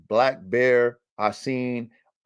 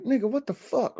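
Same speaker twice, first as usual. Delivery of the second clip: high, softer mock complaint; mock frustration; 13 semitones higher, 5 dB quieter than usual. Owner to his barking dog, high mock frustration. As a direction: squeal it softly in retold exasperation. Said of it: "I was kinda frustrated".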